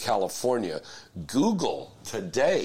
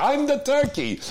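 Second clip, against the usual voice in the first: put-on Yiddish accent; 6 dB louder than usual. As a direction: squeal it like a Yiddish grandma.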